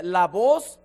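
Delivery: slow, loud preached reading; preaching scripture. Acted. Preacher reading God's words to Cain, slow and loud. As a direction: let each word of the verse ring out. Reading Genesis verse with emphasis.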